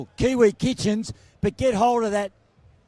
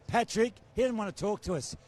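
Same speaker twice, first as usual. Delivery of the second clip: usual speaking voice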